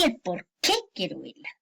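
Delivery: QUÉ punched within "el por qué"; stress on QUÉ. Shown as a demonstration. Teacher reading an example to students, QUÉ strongly stressed. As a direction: mark the accent on QUÉ clearly. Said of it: "que se marque la acentuación".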